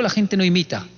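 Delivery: ordinary talk, nothing marked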